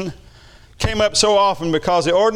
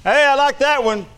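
pleased exclamation; 8 semitones higher than usual. Real, not acted. Delighted aside on translation.

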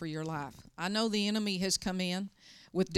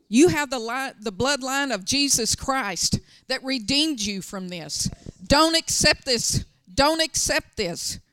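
commanding shout; 4 semitones higher, 8 dB louder than usual. Retold or imitated. Pastor commanding the crying deacon, firm shout. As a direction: order him to stop it. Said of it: "and I said".

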